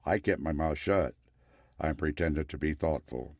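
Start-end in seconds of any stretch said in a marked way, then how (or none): none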